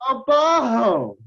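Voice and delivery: "bajo" drawn out as BAH-ho, high and loud; revealed Spanish pronunciation. Shown as a demonstration. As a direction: call out "bajo" slowly with a breathy Spanish J. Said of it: "Here I thought she was cursing".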